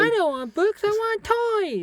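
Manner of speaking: put-on high, slow whiny kid voice; mock child. Mocking a spoilt kid wanting toys, high whiny voice.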